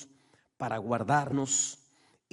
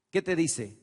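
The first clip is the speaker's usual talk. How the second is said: ordinary talk, nothing marked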